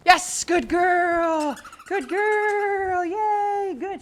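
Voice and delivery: high, loud, slow praise as if the dog won a jackpot; gushing dog praise. Real, not acted. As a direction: reward her with your voice, stretched and bright. Praising dog after recall.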